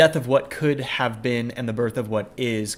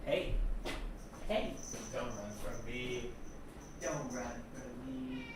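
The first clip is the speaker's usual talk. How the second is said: quiet, gentle call to a cat; soft coaxing; 11 dB quieter than usual. Real, not acted. Streamer softly coaxing his cat not to run away, gently.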